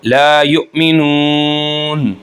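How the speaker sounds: ला drawn very slow for one alif, then युमिनून; stretched la. Shown as a demonstration. Teacher demonstrating madd on ला. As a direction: hold the ला long, then a light युमिनून. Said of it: "लाम को एक अलिफ के बराबर खींचेंगे".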